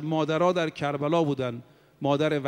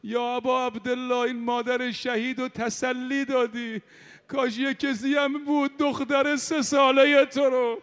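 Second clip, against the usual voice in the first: mourning sermon; 6 semitones higher than usual. Acted. Preacher recounting Karbala to mourners in a rising lament. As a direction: preach the martyr's story with rising grief.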